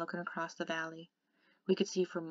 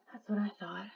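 drowsy; 2 semitones higher than usual. A narrator's reading. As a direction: say it half asleep. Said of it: "I said drowsily".

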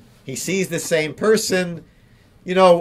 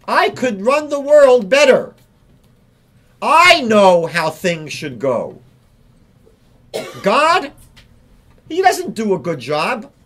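arrogant angry person; 4 semitones higher, 4 dB louder than usual. Retold or imitated. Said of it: "the person that gets angry feels".

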